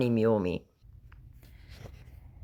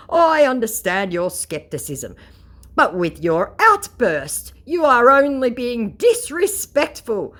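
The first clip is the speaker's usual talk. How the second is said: higher, slow, pompous scammer voice; mock scammer; 11 semitones higher, 5 dB louder, slower than usual. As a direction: lay it on like a self-important conman. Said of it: "declares my scammer".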